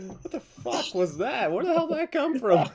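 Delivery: baffled laughing outburst; incredulous. Real, not acted. Shocked at own slip.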